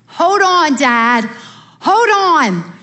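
high urgent yell of hold on; yelling to dad. Retold or imitated. Daughter at father's deathbed yelling for him to hold on. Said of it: "And I yelled".

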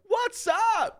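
very high, squeaky "what's up"; mock hyper greeting. Hyper barista greeting sleepy customers, squeaky high. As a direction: chirp it at the top of the voice. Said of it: "he's so like".

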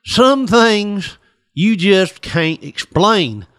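very slow, drawn-out mysterious line; slow, dragged-out cryptic sigh of a line; weary farmer. Acted. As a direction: let the words hang slowly like a tired old man. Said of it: "he's like".

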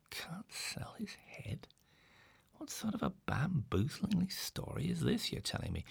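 a high, breathless whisper of disbelief; incredulous whispered aside; baffled whisper. A narrator's reading. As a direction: hiss the question in amazement. Baffled inner outburst, unvoiced.

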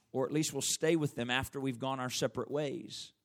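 ordinary talk

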